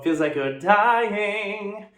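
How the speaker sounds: voice cracking. Shown as a demonstration. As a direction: sing it with a cracking break, slow and high. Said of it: "letting that crack go into it".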